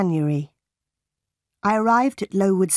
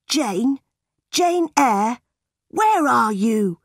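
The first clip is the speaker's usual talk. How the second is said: calling out, pitched up; drawn-out call in search of someone; mild shout; 3 semitones higher, slower than usual. A narrator's reading. Cousin John hunting for Jane, calling out. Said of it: "John shouted".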